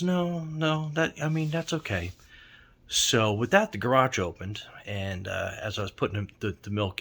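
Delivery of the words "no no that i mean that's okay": lightly voiced child, higher pitch; a hint of a little girl declining, pitched up; young girl. Girl turning down the driver's offer of help, lightly voiced higher. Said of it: "And she goes".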